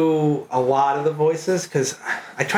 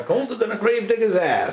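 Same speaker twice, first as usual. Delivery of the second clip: higher mock Maine delivery of "ass"; Maine saying; 7 semitones higher than usual. Recalling dad's cold-weather sayings, a higher mock Maine lilt. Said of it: "it'd be like".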